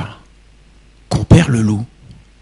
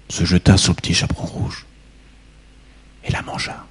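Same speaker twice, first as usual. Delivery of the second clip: whisper; 3 semitones lower, 5 dB quieter than usual. A narrator's reading. Narration whispered at the climax.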